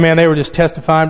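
ordinary talk

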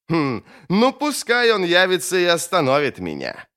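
proud wizard daring anyone to stop him; defiant challenge. Acted. Bayaz defiantly challenges absent lawgiver.

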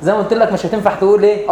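normal talking voice

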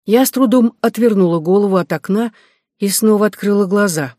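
ordinary delivery

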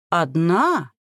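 a short, astonished, croaky question; hoarse with surprise; surprised croak. A narrator's reading. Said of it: "удивлённо прохрипела".

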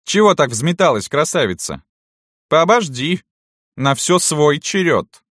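high, loud mocking mistress; cutting her short. A narrator's reading. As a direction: rein the girl in from above, drawing out the end. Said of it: "Остановила её порыв Салтычиха". (барыня властно осаживает)

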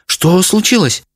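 high, alarmed question; frightened exclamation. A narrator's reading. Mother asking husband what happened, frightened. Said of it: "испуганно воскликнула мама".

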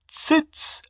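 short high-pitched bark; barked command. A narrator's reading. Harry silencing the shrieking portrait, barked. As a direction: bark it briefly, without great volume. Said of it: "рявкнул Гарри".

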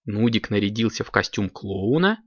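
ordinary delivery, nothing marked

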